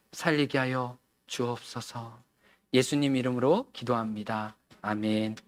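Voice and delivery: soft, hushed prayer voice; quiet prayer. Acted. Pastor praying for the congregation, softly and reverently. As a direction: pray softly and intimately.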